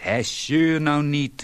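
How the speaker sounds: husband snaps at his nagging wife, irritated and loud; irritated outburst. A narrator's reading. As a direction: shut her up, annoyed. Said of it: "riep hij geprikkeld".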